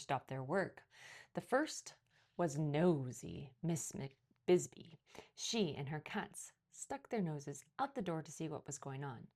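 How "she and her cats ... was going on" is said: usual speaking voice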